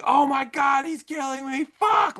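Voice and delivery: high-pitched screaming for your life; imitated scream. Horror fan imitating an actor's death scene, screaming. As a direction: imitate a horror victim screaming in mortal panic. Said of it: "The way he's screaming".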